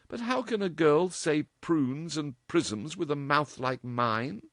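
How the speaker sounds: dance with mischief; mischievous girl. A narrator's reading. Dorothy voiced, mischief.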